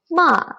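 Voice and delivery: MA dropping from high; fourth tone. Shown as a demonstration. Teacher leading learners together, ma falling. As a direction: fall firmly on MA from the top.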